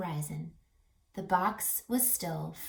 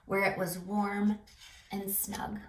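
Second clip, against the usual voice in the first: usual speaking voice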